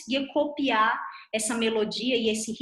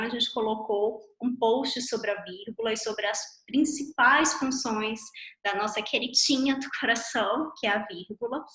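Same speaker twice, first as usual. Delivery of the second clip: ordinary delivery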